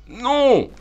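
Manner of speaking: high, loud shouted no; cri de refus. Real, not acted. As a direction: yell it out in alarm at the game. Panique devant le danger en jeu. (cri de refus, aigu et fort)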